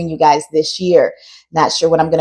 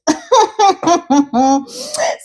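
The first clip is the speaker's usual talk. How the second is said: high giggle; 12 semitones higher than usual. Real, not acted. Laughs at the llama acting up.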